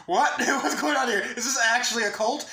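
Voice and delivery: high, loud, laughing shock; laughing disbelief at the group chat. Retold or imitated. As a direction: blurt it out half laughing in disbelief.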